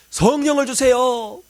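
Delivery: slow, high and loud plea; slow pleading. Retold or imitated. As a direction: drag out the begging. Retold desperate prayer. (느리고 간절한 간구)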